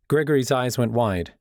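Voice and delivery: ordinary delivery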